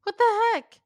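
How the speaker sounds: high, loud mock outrage; high outraged exclamation; indignant. Real, not acted. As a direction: shriek it in fake indignation. Outraged fan reaction.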